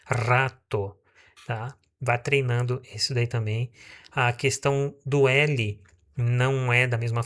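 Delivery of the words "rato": "rato" with a trilled R at the start; rolled R. Shown as a demonstration. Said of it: "Por exemplo". ("rato" com R vibrante)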